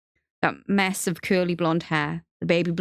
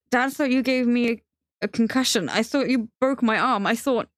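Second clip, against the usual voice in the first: high, agitated run of accusations; hurt voice pitched up, breaking off unfinished; upset accusation; 6 semitones higher than usual. Acted. Benji confronting Theo over the injuries, upset and accusing.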